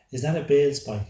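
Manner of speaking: a touch of excited surprise; mildly tripping over words. A narrator's reading. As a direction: blurt the question with mild excitement. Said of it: "almost tripping over his words".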